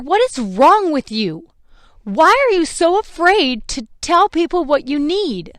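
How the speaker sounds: high, loud, exasperated scolding; berating herself. Retold or imitated. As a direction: scold yourself with rising force. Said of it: "I just instantly started berating myself".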